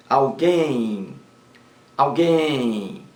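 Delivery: "alguém" with the final guém drawn out; stress on last syllable. Shown as a demonstration. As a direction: linger on the stressed end of "alguém". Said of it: "Oxítona".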